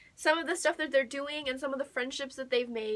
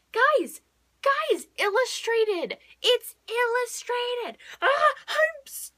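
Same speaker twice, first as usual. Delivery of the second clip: very high, loud, slow squeal breaking into a cry; overwhelmed with joy; squealing excitement; 11 semitones higher, 6 dB louder, much slower than usual. Real, not acted. Booktuber squealing to viewers over the illustrated edition. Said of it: "I am so excited".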